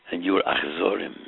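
ordinary delivery

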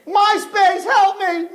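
high desperate scream; screaming for help. Acted. King in the boiling pot screaming for help. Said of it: "he started to scream the name".

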